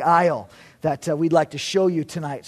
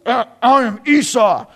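louder, put-on big-brother voice; disguised son; 6 semitones higher, 8 dB louder than usual. Jacob poses as his hairy brother, disguising his voice loudly. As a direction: redo the false claim in a louder disguised voice.

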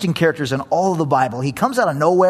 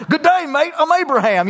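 high, bright Aussie tour-host greeting; hammed-up Australian Abraham greeting the tourists; cheery Australian accent, played up; 8 semitones higher than usual. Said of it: "with a thick Australian accent".

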